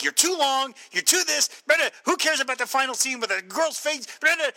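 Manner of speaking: loud, high-pitched imitation of a heckler; mock yelling. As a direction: heckle as an angry audience, hammed-up. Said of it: "he's yelling at the movie".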